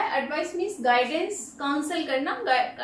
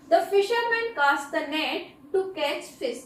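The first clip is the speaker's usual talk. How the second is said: very slow, every word spaced out; slow dictation; much slower than usual. Shown as a demonstration. Teacher reading an example sentence very slowly to students. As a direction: read it out slowly so students can write it down.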